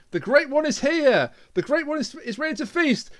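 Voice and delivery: high, hammed-up herald of a monster; grand proclamation. Acts out cultist proclaiming the vampire.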